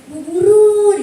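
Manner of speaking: put-on child's high sing-song call to teacher; sing-song student call. Murid memanggil guru dengan nyanyian. (panggilan murid bernada tinggi)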